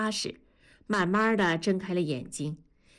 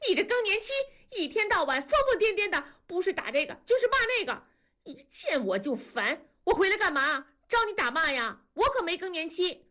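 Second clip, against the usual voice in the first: much higher, fast defiant yelling; higher than mother; 11 semitones higher, faster than usual. A narrator's reading. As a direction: talk back, pitched above her mother. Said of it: "声音比文丽还高".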